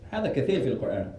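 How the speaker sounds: usual speaking voice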